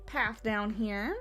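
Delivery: usual speaking voice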